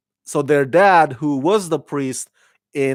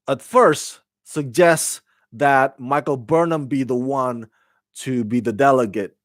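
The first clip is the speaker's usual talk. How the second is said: usual speaking voice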